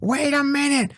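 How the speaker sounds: a director suddenly frantic about continuity; mock shrill panic from the director; panicked director. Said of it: "freaks out at that point and goes".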